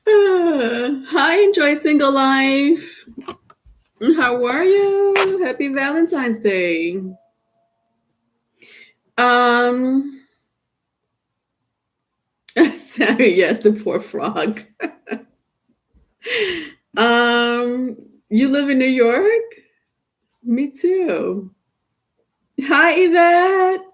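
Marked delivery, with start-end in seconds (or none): 0.1-7.0 high, drawn-out sing-song hello; gushing greeting. Real, not acted. Host greeting a viewer named Enjoy Single Life, high gushing hello. 22.6-23.8 high, drawn-out sing-song hello; gushing greeting. Real, not acted. Host greeting a viewer named Enjoy Single Life, high gushing hello. Greeting her cousin on the live.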